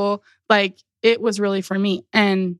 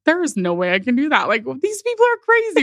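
incredulous disbelief; 6 semitones higher, faster than usual. Retold or imitated. Retelling the arrow exercise to a friend, incredulous. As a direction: pitch shooting high on crazy, mock-shocked.

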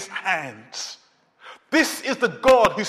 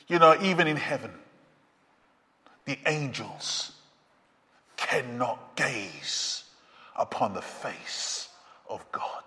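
awed hush; 9 semitones lower, 8 dB quieter than usual. Acted. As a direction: speak it like something too holy to say aloud. Awed hush about the angels.

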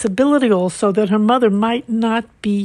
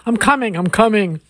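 a touch of a throaty man calling; lightly voiced man calling through a door. A narrator's reading. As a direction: call it out with a hint of throatiness. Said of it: "A man's throaty voice called to her".

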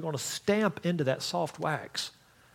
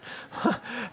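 breathy giggle; 6 semitones higher, 5 dB quieter than usual. Real, not acted.

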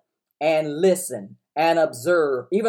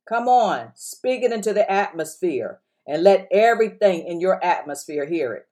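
usual speaking voice

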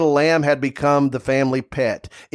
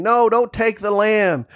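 mock child's plea; 7 semitones higher than usual. Children pleading over the lamb.

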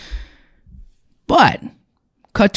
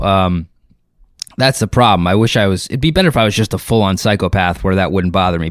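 usual speaking voice

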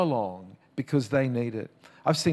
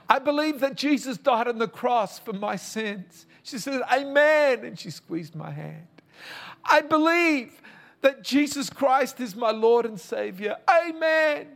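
preach; 11 semitones higher than usual. Retold or imitated. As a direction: let the prayer soar to its peak. Climax of the retold prayer.

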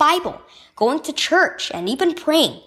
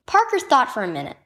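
usual speaking voice

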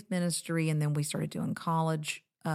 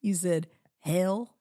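put-on Alabama accent; 2 semitones higher, slower than usual. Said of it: "Making fun of your Alabama accent".

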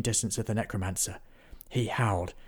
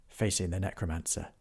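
ordinary delivery, nothing marked

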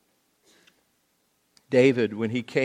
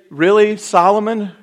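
loud, higher incredulous jealousy; hammed-up jealous David; mock indignant David; 10 semitones higher, 11 dB louder than usual. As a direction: scoff at the unfairness. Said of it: "He didn't say".

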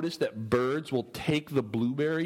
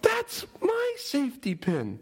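high-pitched mock claim; 11 semitones higher, slower than usual. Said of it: "They're like".

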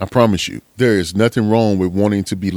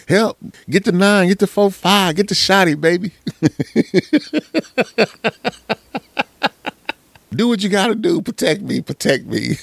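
much higher frantic pleading; a tiny dog begging its owner to grab a gun; little dog voice; 10 semitones higher than usual. Retold or imitated. Owner voicing his dog demanding protection, much higher. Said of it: "That's what I say with my little dogs".

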